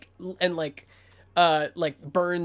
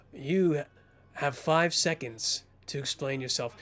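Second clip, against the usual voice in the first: quiet threat; 4 semitones lower, 4 dB quieter than usual. Acted. Cobra threatens cornered Bo.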